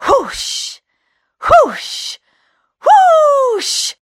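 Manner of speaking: breathy magical whooshing; rising magic whoosh. Acted. Magic spell effect.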